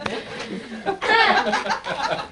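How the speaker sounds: breathy laugh; real laugh. Real, not acted. Host laughing at the mistake, real laugh. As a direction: crack up.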